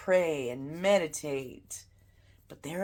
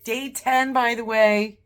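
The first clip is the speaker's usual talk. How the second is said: higher and louder, proud burst; excited announcement; 7 semitones higher, 9 dB louder than usual. Real, not acted. Telling viewers her day count, proud loud exclamation. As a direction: blurt out the milestone with pride.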